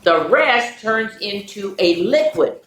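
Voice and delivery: ordinary delivery, nothing marked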